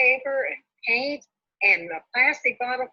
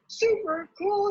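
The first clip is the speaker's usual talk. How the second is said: mild excited yell; 9 semitones higher than usual. A narrator's reading. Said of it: "yells Callie".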